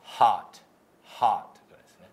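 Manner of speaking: 「Hot」の母音を顎を下げて大きく; 口を大きく開けて大きめにHot; 英語のHot見本. Shown as a demonstration. (大きめのアメリカ英語のHot)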